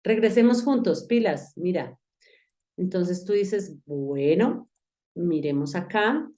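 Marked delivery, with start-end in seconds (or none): none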